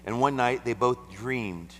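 slow, high, loud reading. Acted. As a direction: draw out the dream moment. Slow raised scripture reading.

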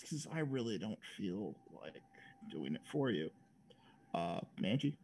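trailing off into a low whisper; quiet mutter. Real, not acted. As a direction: mumble it under your breath. Host drops voice, whispers.

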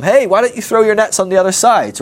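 shouting out. Acted. Someone on the beach to fishermen, calling out. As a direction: call out like you're across the water. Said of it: "someone on the beach shouts out to them".

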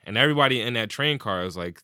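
normal talking voice

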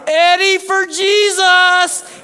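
very high, loud, stretched yell; imitated yell. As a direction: holler it from the field like a fan. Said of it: "yelling from the dugout".

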